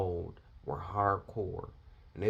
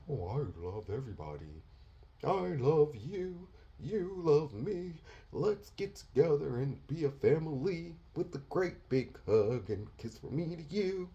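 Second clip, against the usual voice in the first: mocking soft pastor; 5 semitones higher than usual. Preacher mocking soft Sunday pastors, high and syrupy. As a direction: coo it like a soppy preacher. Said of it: "That's how you Sunday pastors make".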